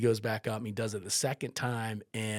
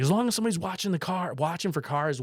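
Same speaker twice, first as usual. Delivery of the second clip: excited, fast; 8 semitones higher, 6 dB louder than usual. Retold or imitated. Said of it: "So he's like".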